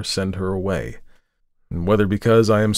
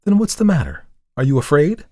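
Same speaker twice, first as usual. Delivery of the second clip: pressing question; 8 semitones higher, 5 dB louder than usual. Acted. He presses the trembling girl.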